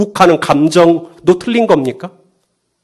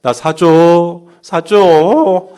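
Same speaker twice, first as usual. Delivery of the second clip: put-on very slow, drawn-out childish begging; whining child tantrum; 2 semitones higher, much slower than usual. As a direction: whine for a toy, dragging each word out. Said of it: "이제 떼를 쓰기 시작하죠". (떼쓰는 아이처럼 길게 늘여 조르는 말투)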